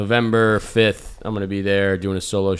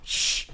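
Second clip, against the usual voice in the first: long unvoiced shush; hushing; 7 dB quieter than usual. Host cutting off talk of money, a hushing sound.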